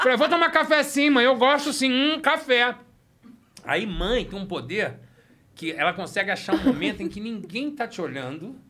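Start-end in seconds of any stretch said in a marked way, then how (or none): 0.2-2.7 put-on defiant kid. Defiant boy insisting.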